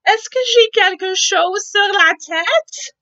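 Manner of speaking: high, loud anxious question; worried elephant. Acted. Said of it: "Gérald dit".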